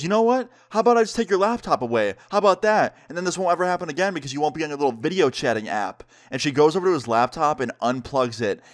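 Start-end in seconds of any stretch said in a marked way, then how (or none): 0.0-6.0 high-pitched, quick mock scolding; threatening angry mom. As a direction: act out a mother laying down a punishment. Acted mom threatening to take laptop.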